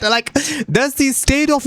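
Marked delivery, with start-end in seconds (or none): none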